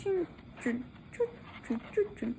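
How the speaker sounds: soft rhythmic chun, chun; quiet swinging chant; rocking chun sounds. Shown as a demonstration. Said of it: "le hice con las manitas así".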